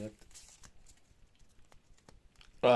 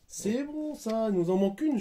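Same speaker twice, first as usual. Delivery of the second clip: excited squeal; 10 semitones higher than usual. Real, not acted. Excited squeal over the set.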